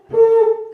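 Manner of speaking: high, loud imitated beep; short sung-out boop; voicemail beep. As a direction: make a piercing answering-machine beep. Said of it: "It's like".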